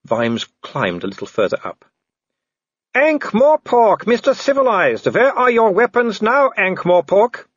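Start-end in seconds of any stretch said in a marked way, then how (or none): 2.9-7.5 high, loud taunting villain; Wolfgang. Acted. Werewolf taunting the man up the tree, high mocking call. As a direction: call out mockingly to cornered prey. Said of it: "It was Wolfgang's voice".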